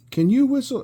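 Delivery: high, loud questioning call; imitated older guy. Older guy asking the kid to whistle, loud high call. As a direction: holler it high, as the man asking. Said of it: "so he's like".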